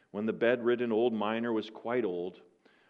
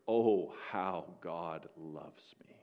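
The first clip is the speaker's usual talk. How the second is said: tender assurance; 6 semitones lower, 5 dB quieter, much slower than usual. Retold or imitated. Miner's answer to Satan.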